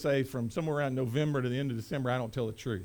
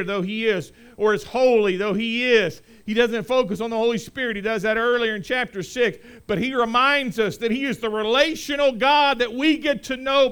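forceful preaching; 10 semitones higher, 11 dB louder than usual. Acted. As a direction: build to a loud, high proclamation.

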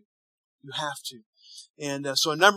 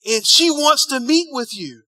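excited news; 6 semitones higher, 6 dB louder than usual. Retold or imitated. Caller reports good news.

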